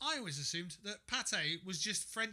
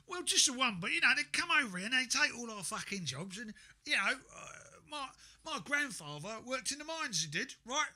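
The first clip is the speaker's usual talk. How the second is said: louder, higher ranting bloke; worked-up tradesman; 5 semitones higher, 4 dB louder than usual. Retold or imitated. Mocking a pub tradesman, louder rising rant.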